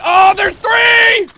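loud, slow excited shout. Real, not acted. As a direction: yell out the callout in alarm. Spotting enemies mid-game.

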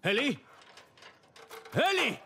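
loud, rising call searching for someone. Acted. Calling out for missing Eli. (llamando a gritos a Eli, preocupado)

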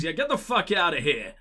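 usual speaking voice

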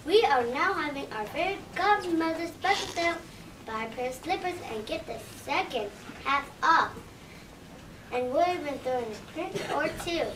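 upbeat commercial voice selling slippers; ad read. Acted. Shoe store ad selling slippers to listeners, upbeat pitch. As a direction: pitch it like a radio spot.

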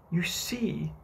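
a touch of complacency; complacent. A narrator's reading. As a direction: say it with mild smugness. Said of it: "Wendy said complacently".

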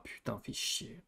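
voiceless muttered swear; hushed fais chier; whispered curse. Real, not acted. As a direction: curse it under your breath.

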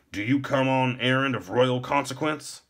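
high, stiff guard's questioning; officious gatekeeper. A narrator's reading. Stiff gate warden questions visitor.

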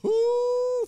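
excited cry. Real, not acted.

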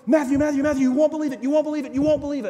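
high, loud, thrilled yelling; excited screaming. Retold or imitated. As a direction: shout the news down the phone, overjoyed. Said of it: "she's screaming and yelling".

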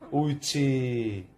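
squeaky praising a dog. Real, not acted. Praising dog after paw.

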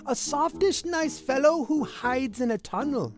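ordinary delivery, nothing marked

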